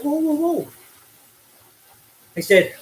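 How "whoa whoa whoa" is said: high, drawn-out whoa rising in alarm; high-pitched mock alarm, not shouted; retold protest. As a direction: re-enact stopping someone in their tracks. Acts out halting the intruding copper.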